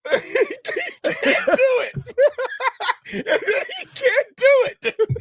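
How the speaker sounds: high, breaking into laughter; can barely get words out laughing; laughing hard. Real, not acted. Cracking up at the video.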